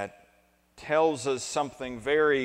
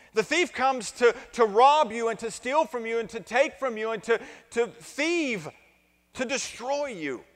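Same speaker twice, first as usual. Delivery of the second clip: louder, higher, driving sermon voice; fiery preaching; 9 semitones higher, 6 dB louder than usual. Acted. Pastor driving home the point to his congregation, full-force preaching. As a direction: preach it out to the whole church with rising fire.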